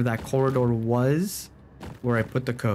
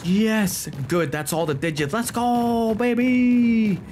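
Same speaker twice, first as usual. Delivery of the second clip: triumphant cheer; 8 semitones higher than usual. Real, not acted. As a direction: cheer like you just won. Celebrating success.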